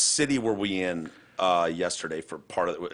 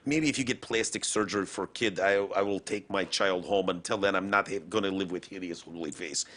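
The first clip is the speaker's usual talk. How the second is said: put-on Russian accent; 2 semitones lower, 5 dB quieter than usual. Host voices a woman refusing her baby, Russian accent. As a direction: imitate a stubborn speaker in Russian-accented English. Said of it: "That's the greatest Russian accent I've ever heard".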